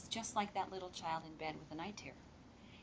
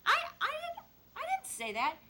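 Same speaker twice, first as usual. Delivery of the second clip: put-on defensive child; 4 dB louder than usual. Child denies what they said.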